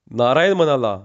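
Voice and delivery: plain reading voice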